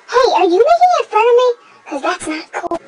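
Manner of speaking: mock indignant. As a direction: play offended, quick and loud. Mock upset at hater.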